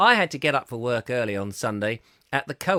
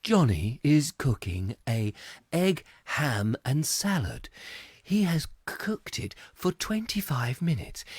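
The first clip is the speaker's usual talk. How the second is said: mock cooking-show voiceover.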